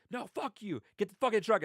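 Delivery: high-pitched mock fury; retold angry order. Driver forcing prank victim into trunk, high angry order.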